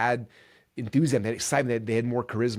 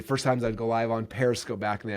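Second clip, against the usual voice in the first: normal talking voice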